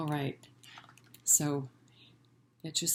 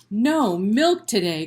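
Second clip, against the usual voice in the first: loud, higher outcry of a farmer; dismayed cry; 4 semitones higher, 9 dB louder than usual. Acted. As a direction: wail it in disbelief. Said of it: "cried Farmer Brown".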